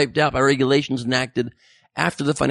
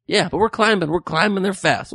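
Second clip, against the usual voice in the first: usual speaking voice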